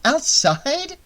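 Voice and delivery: high, rising incredulous question; questioning echo. A narrator's reading. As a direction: repeat it back in disbelief. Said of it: "Annie mused".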